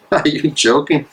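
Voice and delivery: short scoffing laugh at the notion; incredulous laugh. Acted. Minister laughs at his friend's naive suggestion. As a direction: laugh it off as absurd.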